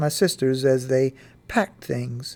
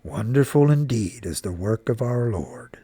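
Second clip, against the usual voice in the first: lowered, reverent fatherly murmur; low murmur; 5 semitones lower than usual. A narrator's reading. Papa marvelling at the Lord's work, murmuring low. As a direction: murmur it low, almost to yourself. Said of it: "I heard Papa murmur in a low voice".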